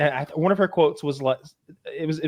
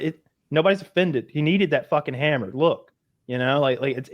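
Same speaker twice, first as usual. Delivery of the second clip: usual speaking voice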